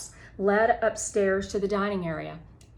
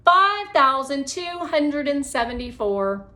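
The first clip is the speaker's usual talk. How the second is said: confident answer; 6 semitones higher, 7 dB louder than usual. Acted.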